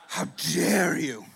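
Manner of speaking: slow, low offended client voice; hammed-up wounded dignity, low and slow; mock indignation. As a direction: draw it out low, acting deeply affronted. Offended client repeats his reproach.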